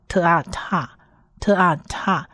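特 and 啊 sounded apart, then blended into 踏; sounding out syllables. Shown as a demonstration. Teacher joins consonant and vowel for learners, sounding out 特啊踏. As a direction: blend 特 plus long 啊 into 踏. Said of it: "所以就是特加上下面的小t的母音".

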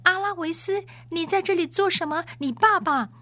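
very high-pitched cry of recognition; loud exclaiming. A narrator's reading. Noblewoman spotting her old friend from the litter, crying out high. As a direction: call out high to an old friend, mildly shouted. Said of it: "用最大的声音喊道".

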